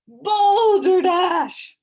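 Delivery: drawn-out, loud booming scoff; pompous beetle bellowing his scorn; booming shout. A narrator's reading. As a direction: boom it out to silence the room. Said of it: "shouted a booming voice".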